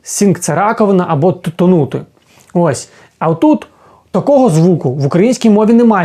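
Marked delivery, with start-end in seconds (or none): none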